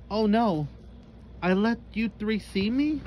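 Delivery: panicked. Acted. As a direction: fret aloud at being caught.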